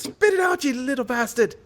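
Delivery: high screaming dwarf punchline. Acted. The dwarf screams at the fly in his beer, high and shrill. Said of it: "and screams".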